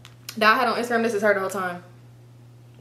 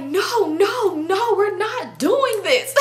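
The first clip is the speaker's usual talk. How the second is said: high, drawn-out mock refusal; acted friend's protest; 9 semitones higher, much slower than usual. Friend stops the fight, high drawn-out protest. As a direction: stretch the mimicked objection, pitched up high.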